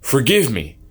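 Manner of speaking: high, loud furious shout; angry cry. Acted. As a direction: hurl it into the dark in rage. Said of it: "Nahmun's tears turned into anger".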